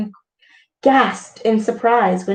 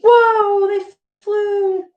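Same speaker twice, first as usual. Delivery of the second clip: amazed whoa; 11 semitones higher, slower than usual. Real, not acted. Reader amazed.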